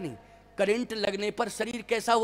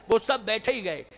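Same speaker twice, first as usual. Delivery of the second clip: ordinary talk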